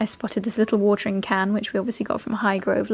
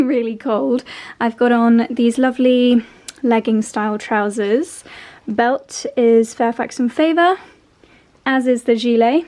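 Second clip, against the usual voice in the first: normal talking voice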